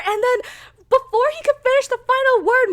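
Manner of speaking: high, loud, fast rush; racing breathlessly to the climax; worked up. Real, not acted. Reviewer racing through the fatal moment, high and loud. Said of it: "I'm getting way too worked up".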